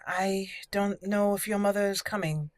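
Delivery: usual speaking voice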